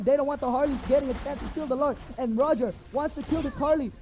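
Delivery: rising, excited esports casting; live play-by-play. Acted. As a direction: call the fight fast and hyped.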